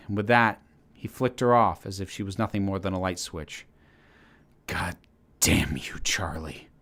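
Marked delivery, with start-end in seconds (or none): 4.7-6.7 growled. A narrator's reading. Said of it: "he growled".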